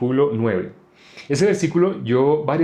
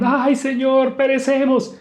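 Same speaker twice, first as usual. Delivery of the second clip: panicked, much higher cry to the Lord; frightened cry; 13 semitones higher, 4 dB louder, slower than usual. Retold or imitated. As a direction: voice the disciples' terror in the storm. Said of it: "ellos se asustaron". (grito asustado de los discípulos al Señor, mucho más agudo)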